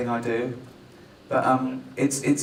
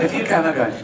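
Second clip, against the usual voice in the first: ordinary delivery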